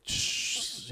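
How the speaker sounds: a high, breathy shush. Retold or imitated. Son shushing his mom near the celebrity. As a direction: hush mom fast so he won't hear.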